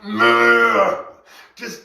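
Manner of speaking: high, mock-gleeful plea for the purge; inner wild side. Voicing his hidden aggressive side, mock gleeful. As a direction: ham up a giddy urge to cut loose. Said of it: "there's this huge part inside of me that's like".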